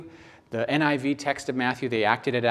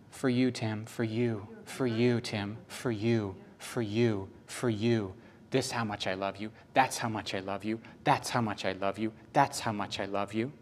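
softer, hushed, repeating it like a gentle promise; tender divine voice; 4 semitones lower, 8 dB quieter than usual. Acted. God softly telling Tim how much he loves him, tender and hushed.

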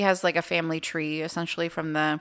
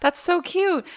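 high, loud, thrilled squeal; excited gushing; 13 semitones higher, 5 dB louder, slower than usual. Retold or imitated. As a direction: gush with delight, much higher and louder. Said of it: "And I was like".

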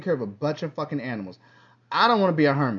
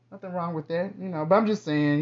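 usual speaking voice

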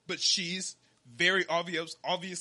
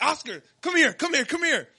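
higher, louder urgent call; mock eager call to the team leader; 7 semitones higher, 7 dB louder than usual. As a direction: wave the boss over eagerly. Calling team leader over.

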